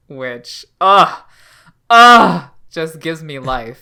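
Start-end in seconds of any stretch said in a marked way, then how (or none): 0.8-2.5 loud, high groan of relish; big gushing "ugh"; emphatic groan. Real, not acted. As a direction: groan it out, overcome with delight. Gushing over a favourite flashback.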